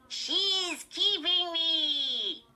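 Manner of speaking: high, loud, very slow puppet voice; squeaky dummy voice. A narrator's reading. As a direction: drag it out like a ventriloquist's dummy. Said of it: "she made the dummy say".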